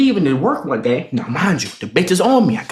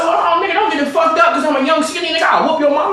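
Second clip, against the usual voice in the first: mock outrage; 8 semitones higher than usual. Acted boast back at a threat.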